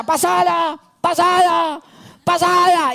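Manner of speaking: high, urgent shout demanding the ball; teammate yelling pass it. Retold or imitated. A pushy teammate yelling for the ball, high urgent shout. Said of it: "hay uno que te grita".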